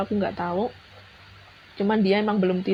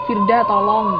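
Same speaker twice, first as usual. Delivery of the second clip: louder, retold cry for help; imitated call for help; 7 dB louder than usual. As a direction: call out as a friend needing help. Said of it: "tiba-tiba Mas Sony bilang". (menirukan teriakan minta tolong)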